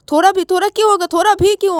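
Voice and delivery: high, heated demand; worked-up indignant questioning. Acted. Acted quarrel, indignant demand.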